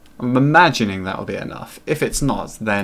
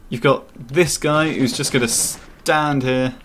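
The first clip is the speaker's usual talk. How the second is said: normal talking voice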